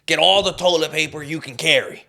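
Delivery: hammed-up wife barking a shopping list; mock bossy wife. Wife sending husband to the store, mock bossy order.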